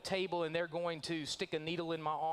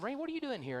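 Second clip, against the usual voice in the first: high, astonished disbelief; shocked friend; 9 semitones higher, faster than usual. Acted. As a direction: blurt it out like you've seen a ghost. Churchgoer shocked to see Randy.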